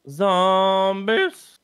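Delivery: loud, high drawn-out holler; hammed-up zombie shout; playful call-out. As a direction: sing out a mock alarm, loud and high. Playfully calling out the game's enemies.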